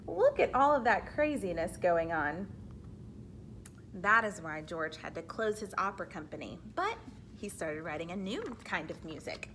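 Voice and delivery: ordinary talk, nothing marked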